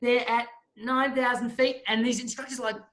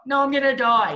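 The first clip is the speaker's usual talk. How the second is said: louder, higher panicky insistence; panicked protest; 4 semitones higher, 6 dB louder than usual. Retold or imitated. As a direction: blurt it out in mock panic. Panicking student argues with instructor.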